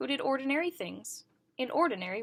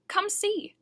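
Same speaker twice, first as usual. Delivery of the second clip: called out; 12 semitones higher, 3 dB louder than usual. A narrator's reading. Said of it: "he called".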